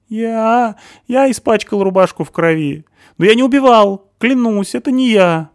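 high, loud frightened stammering; cornered man stammering; panicky stammer. A narrator's reading. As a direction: stumble over the words in fear. Stasik pleading.

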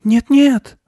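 high, loud panicked mouse squeak; terrified little mouse; horrified squeak. A narrator's reading. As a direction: squeak it out in horror. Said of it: "в ужасе пискнул мышь".

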